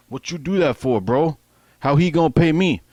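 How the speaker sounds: a menacing Samoan kingpin pressing in island English; island talk. Retold or imitated. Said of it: "in island talk".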